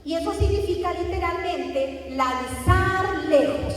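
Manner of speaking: slow, weighty "significa literalmente lanzar lejos"; emphatic definition. Shown as a demonstration. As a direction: spell out the meaning slowly. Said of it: "la palabra afin".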